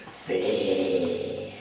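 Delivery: deep drawn-out cry of a name; low giant call. Acted. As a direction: call out low across the distance. Giant spots her far away.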